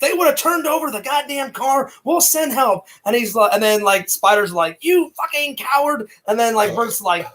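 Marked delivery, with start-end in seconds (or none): 0.0-1.9 high-pitched, heated mock argument; excited retelling. Bert argues with Spider in retold film, excited. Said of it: "I get really excited sometimes". 2.0-2.9 high-pitched, urgent imitation; excited retelling. Bert answers Spider in retold film, excited. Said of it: "I get really excited sometimes". 4.8-6.1 high-pitched mock accusation; excited retelling. Spider accuses Bert in retold film, excited outburst. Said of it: "I get really excited sometimes".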